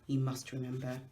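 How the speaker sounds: ordinary delivery, nothing marked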